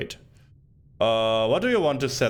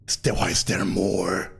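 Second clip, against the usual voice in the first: crazy voice; 7 semitones lower than usual. Acted. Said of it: "read all this in my crazy voice".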